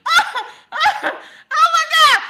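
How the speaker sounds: high squealing laugh, oh my God; real helpless laughter; laughing hard. Real, not acted. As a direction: crack up at your own joke. Laughing fit at own joke.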